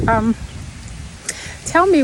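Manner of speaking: normal talking voice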